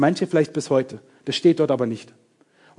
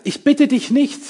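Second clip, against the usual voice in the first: emphatic high quote; 10 semitones higher than usual. Acted.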